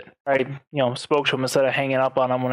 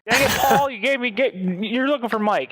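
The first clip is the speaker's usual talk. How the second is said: higher-pitched mock exasperation; exasperated brother act; 8 semitones higher than usual. Pretend brother taking the scammer's call, mock exasperated. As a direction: scold your imaginary brother in a raised pitch.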